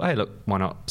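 ordinary talk

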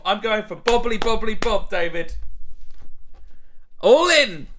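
high, loud bold bet; excited bravado. Acted. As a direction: go all in with gusto. Mock gambling bravado.

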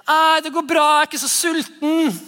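put-on high, breezy kid voice saying no thanks; child turning down dinner; child declining. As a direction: brush off dinner like a snack-stuffed kid.

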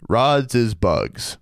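very slow, every word weighed; mock solemn quote. Quoting a listener's field report, very slow mock solemnity. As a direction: deliver it like a sacred truth. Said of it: "as was put so eloquently".

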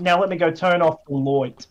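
"loit" said with an oy vowel; broad Australian vowel. Shown as a demonstration. Mate demonstrating "loit" in a sentence, broad oy vowel. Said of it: "instead of, don't say light".

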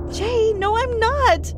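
high, desperate pleading cry; shouting back. A narrator's reading. Girl shouting back at boyfriend who calls her witch, high desperate cry. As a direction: cry out high and fast to stop him. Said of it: "I shouted back at him".

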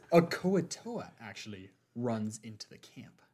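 ordinary talk

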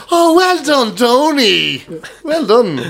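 high, hammed-up congratulation; exaggerated praise. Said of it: "So anything is like".

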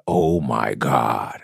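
low, drawn-out awe; retold starstruck moment, slow and low. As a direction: stretch it out slowly, awestruck. Starstruck on seeing his idol.

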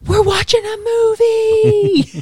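mock-excited class chanting they get a movie; excited kids. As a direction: drag it out like thrilled students. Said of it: "The kids are like".

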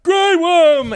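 high, loud, strange put-on shout; weird fake shout. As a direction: bellow the name in a weird put-on voice. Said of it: "in a very weird voice".

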